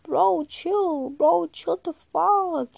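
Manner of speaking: slow teasing bro drawl; mock chill-out plea. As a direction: tell them to calm down, lazily. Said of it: "and I'm like".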